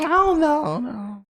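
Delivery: grandma voice. Retold or imitated. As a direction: let it fade to nothing like grandma.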